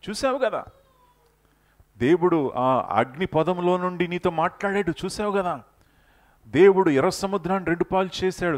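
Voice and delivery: high, loud, rising challenge to the crowd; loud, high preacher rebuking Moses; fiery preaching. Acted. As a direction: press each question home like a revival preacher. Preacher challenging Moses at full force.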